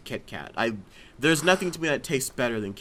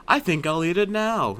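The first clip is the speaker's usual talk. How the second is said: slow, higher cartoon voice; SpongeBob; 4 semitones higher, 4 dB louder, slower than usual. Guest imagining eating the last cream egg, cartoon quote. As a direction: stretch it out like a cartoon character. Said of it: "like SpongeBob".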